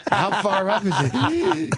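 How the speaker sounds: high, slow, breathless mock wheeze while laughing; wheezing hooker. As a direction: play her gasping up the stairs. Wheezing hooker on stairs quoted.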